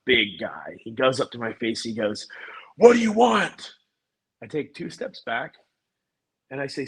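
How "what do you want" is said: high, loud confrontational demand; angry guy. Retold or imitated. Angry homeowner confronts salesman at his door, angry shout. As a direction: imitate an angry man shouting in your face. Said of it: "this angry guy comes out".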